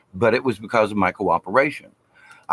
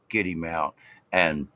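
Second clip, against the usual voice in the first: usual speaking voice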